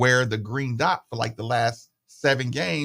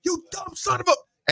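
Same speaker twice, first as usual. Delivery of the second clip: angry coach. Retold or imitated. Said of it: "the coach is like".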